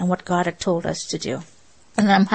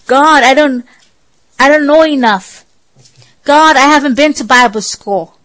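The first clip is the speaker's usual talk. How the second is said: whiny excuses to God; 4 semitones higher, 7 dB louder than usual. Retold or imitated. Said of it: "Or maybe in your case".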